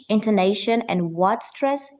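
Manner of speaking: normal talking voice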